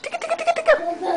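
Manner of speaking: high laughing chase chant; giggly tickle attack. Real, not acted. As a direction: giggly run run run. Tickling and chasing baby.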